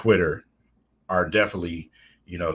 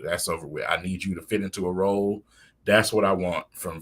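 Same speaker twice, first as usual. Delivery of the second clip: ordinary talk, nothing marked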